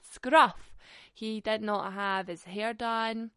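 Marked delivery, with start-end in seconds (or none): 0.0-0.8 loud, scornful Scruff!; a loud scornful repeat; emphatic exclamation. Real, not acted. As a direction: bark the word Scruff with disgust. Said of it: "is some fucking scruff".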